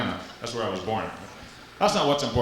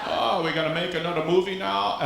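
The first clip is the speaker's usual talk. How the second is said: lisping cartoon hunter voice; squeaky Fudd-like cartoon voice; Elmer Fudd; 6 semitones higher than usual. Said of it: "beneath all those muscles, Elmer Fudd".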